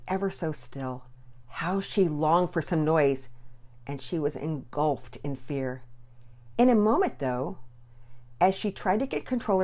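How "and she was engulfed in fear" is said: ordinary delivery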